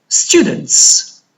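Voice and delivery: STU-dents, first syllable of "Students" lifted; stress on first syllable. Shown as a demonstration. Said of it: "the stress is on the first syllable".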